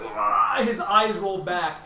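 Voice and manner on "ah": hammed-up higher cry of pain; a short gasping yelp as his heart is ripped out; mock alien death cry. GM voicing the alien crying out as he dies. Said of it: "and he's like".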